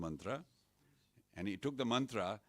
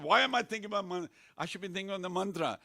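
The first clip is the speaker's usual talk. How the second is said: mild mock shout; 9 semitones higher, 5 dB louder than usual. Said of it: "Then he started to shout".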